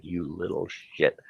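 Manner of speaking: quiet, restrained mock menace; scowling mutter. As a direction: mutter it through a nearly shut mouth. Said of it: "sitting there with my mouth shut, scowling".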